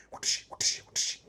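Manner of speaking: three soft unvoiced whooshes; quick hissed spraying noises; imitated water spray. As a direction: mimic short bursts from a sprayer. Imitating a faucet spraying dishes.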